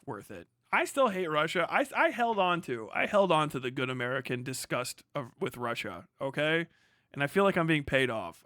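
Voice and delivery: usual speaking voice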